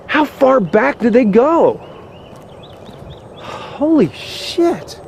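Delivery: slow, high-pitched holy shit; stunned disbelief. Real, not acted. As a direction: let the disbelief stretch the words out. Discovers the whole area cleared.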